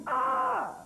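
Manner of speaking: sharp high yelp of a scream; mock scream. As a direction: let out a short fake scream. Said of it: "and scream".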